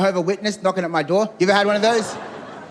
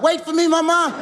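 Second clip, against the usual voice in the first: high put-on pleading call; calling after mama; 9 semitones higher than usual. Customer not wanting to get left behind, high mock call after the lady.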